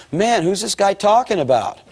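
high-pitched, puzzled inner voice; acted bewilderment. Up in the balcony hearing himself described, acted bewilderment.

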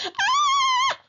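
very high, loud shriek; piercing wail; child's scream. A narrator's reading. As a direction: scream out like an attacked small boy. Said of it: "screamed Perfect Peter".

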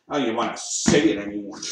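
ordinary talk, nothing marked

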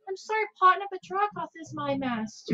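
high sheepish companion voice. Acted. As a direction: confess like a guilty sidekick. Betraying companion confesses.

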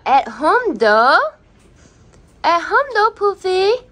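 high, loud sing-song about the puppy; excited pet voice. Real, not acted. Owner describing her lively puppy in high, loud voice. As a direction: gush about how wild she is at home.

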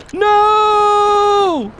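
high loud shout of dismay; real anguished shout. Real, not acted. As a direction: yell no at the screen. Dog killed.

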